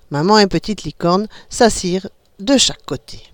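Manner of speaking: ordinary delivery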